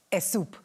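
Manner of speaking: es soup with an eh before soup; extra E sound. Retold or imitated. Panellist imitating her mum saying soup. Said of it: "if she has to say soup".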